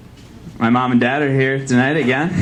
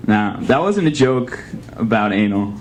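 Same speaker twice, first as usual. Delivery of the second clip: ordinary delivery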